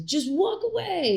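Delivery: high, slow sing-song just walk away; mock advice. Wife imitating husband's advice, high and drawn out.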